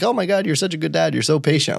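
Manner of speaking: high, quick admiring gush; imitated gushing praise. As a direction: mimic people gushing over a good dad. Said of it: "Some people are like".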